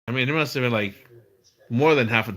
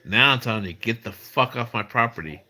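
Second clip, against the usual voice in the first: ordinary talk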